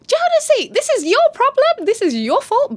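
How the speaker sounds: high, loud mock wife accusing; high mock wife blaming husband; put-on shrill wife. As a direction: whine the blame at Jonesy, way up high. Mocking wife voice.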